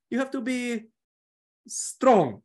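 Italian manager holding back a swear word; Italian accent. Retold or imitated. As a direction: drag it out like an Italian coach. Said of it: "He was like".

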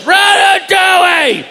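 high, drawn-out fan scream; an audience member screaming a film title at the comic; fan yelling. Retold or imitated. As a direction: shriek the movie title like a fan. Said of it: "then someone goes".

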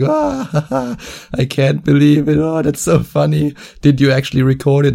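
high laughing voice, giggling through the words; laughing friend. Retold or imitated. Friend on the phone laughing about the prank, high and giggling. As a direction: laugh it out while asking. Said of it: "He was just laughing out straight".

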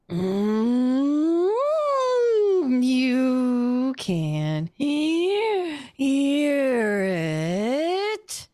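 high, very slow, swooping creature wail; eerie drawn-out raspy creature; long, raspy, windy voice. Acted. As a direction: stretch the words out like howling wind. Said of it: "that long, raspy, windy voice, and it says to you".

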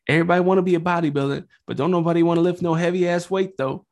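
high-pitched bodybuilder impression; high, excited mock bodybuilder voice; Ronnie Coleman. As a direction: pitch it up high and rattle it off in a put-on voice. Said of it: "he's got a really high voice".

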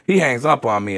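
ordinary talk, nothing marked

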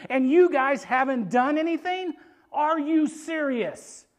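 loud, high, incredulous rebuke; stretched-out, disbelieving challenge at the end; indignant scolding. Acted. David scolding his idle brothers.

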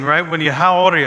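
normal talking voice

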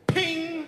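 high mock ping of a coin; high playful ping. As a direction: squeak out the sound of a coin hitting the bar. Coin tossed on the bar.

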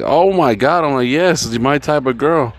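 high-pitched, thrilled exclamation; excited delight. Retold or imitated. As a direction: swoop up high, delighted by her. Delighted reaction to her beer order.

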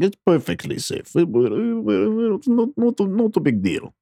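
high, stammering bad Russian accent; hammed-up Russian boss brushing off danger; terrible fake Russian accent. Soviet boss reassuring cosmonaut, fake Russian accent. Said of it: "That's a terrible fake Russian accent".

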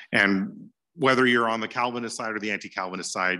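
ordinary talk, nothing marked

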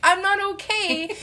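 put-on high, drawn-out child-like wail; upset four-year-old. Four-year-old inner child to adult self, wailing. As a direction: wail it slowly like a small child. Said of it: "this four-year-old is like".

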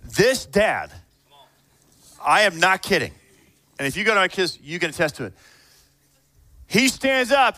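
ordinary delivery

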